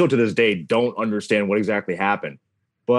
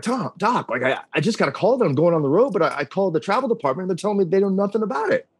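fast, higher-pitched retold panic; a nervous caller rushing to tell the coach what happened; freaking out; 8 semitones higher, faster than usual. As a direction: rush it like you're freaking out. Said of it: "I'm freaking out".

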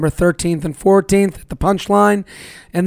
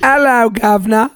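higher-pitched cartoon British greeting; mock British accent; 4 semitones higher, 3 dB louder than usual. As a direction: ham up a cheeky British accent. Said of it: "Is that what it sounds like?".

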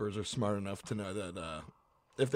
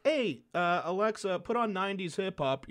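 mock calling out to Alexa; 7 semitones higher, 4 dB louder than usual. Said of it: "But if you're like".

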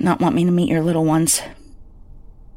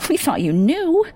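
dazed horror; 6 semitones higher than usual. Acted. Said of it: "my mother's anguished cries".

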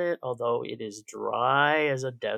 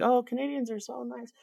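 mock gushing; 11 semitones higher than usual. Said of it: "People think".

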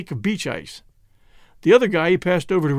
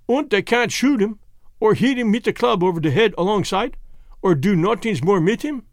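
higher-ended questioning in broken English; Germanic-accented foreigner checking terms; Jan with Germanic accent; 3 semitones higher than usual. Acted.

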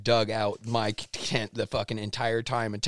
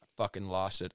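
ordinary talk

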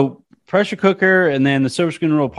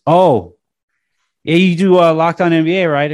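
mock-eager higher, louder driver voice; Uber driver perking up; 6 semitones higher, 5 dB louder than usual. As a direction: play the fan who just recognized him, a bit louder. Uber driver recognizes him.

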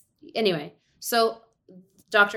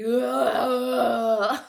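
alien groan; 5 semitones higher than usual. Retold or imitated. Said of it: "sounding like an alien".

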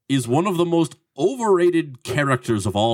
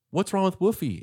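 imitated boy, pitched up; quick hint of a kid's higher voice; John Connor; 6 semitones higher, 3 dB quieter than usual. Terminator as boy asking about dog, higher imitation. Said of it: "And he goes".

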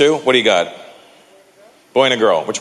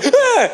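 put-on childish shriek; 3 dB louder than usual. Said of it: "It wasn't like".

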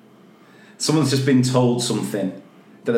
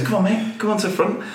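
lightly voiced coaxing, pitched high; coaxing handler; 9 semitones higher, faster than usual. Imitated snake handler calls guest forward, light coaxing. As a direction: urge them along in a higher imitated voice.

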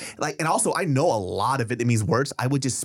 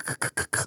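whispered k laugh; 6 dB quieter, much faster than usual. Shown as a demonstration. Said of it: "So they'll go".

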